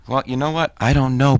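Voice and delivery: ordinary delivery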